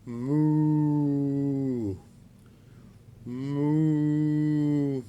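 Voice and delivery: a puppet playing at being a cow; puppet mooing. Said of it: "That is not what sound monkeys make".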